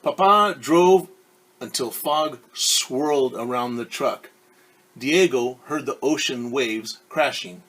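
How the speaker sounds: clear, lively read-aloud voice; storyteller reading a picture book; story narration. A narrator's reading. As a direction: read it to a class of kids.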